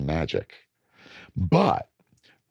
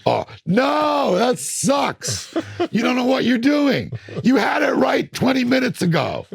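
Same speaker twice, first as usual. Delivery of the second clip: high, loud frustrated yelling at himself; 5 dB louder, slower than usual. Retold or imitated. Alone at the mixing desk, yelling at his own bad mix. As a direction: scold yourself at full volume. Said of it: "I'm like".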